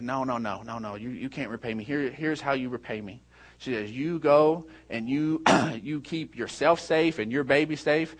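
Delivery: soft, low, caring refusal; hushed and tender, well below preaching voice; retold tender reassurance. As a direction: gently voice a protector sending someone to safety. Maria gently sends mother off.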